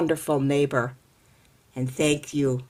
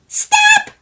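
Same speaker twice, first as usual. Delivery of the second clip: much higher, louder tiny voice; little man shouts; 11 dB louder than usual. Acted. Hidden little man shouting at neighbor, high tiny voice.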